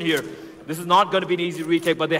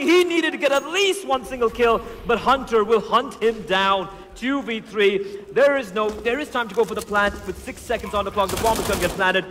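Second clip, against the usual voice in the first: rising hyped caster call; esports caster; 7 semitones higher, 5 dB louder than usual. Acted. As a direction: build up to a roaring call. Climax play-by-play.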